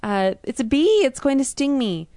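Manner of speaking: put-on high, loud frightened cry of a little girl; mock a scared child shrieking for her dad; terrified scream. Little girl screaming to her father about the bee, high and loud. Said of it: "she screams in terror".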